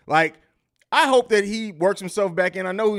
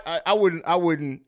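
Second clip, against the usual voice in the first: ordinary delivery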